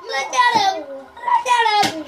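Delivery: ordinary delivery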